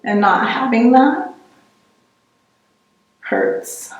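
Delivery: choked up, voice rising then dropping away on the last word; emotional. Real, not acted. Speaker admitting that lacking the language hurts, emotional. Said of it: "Sorry, I get emotional".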